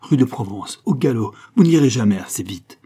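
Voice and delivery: urgent cry to the coachman; shouted order. A narrator's reading. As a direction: call out loudly, desperate for speed. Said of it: "cria au cocher".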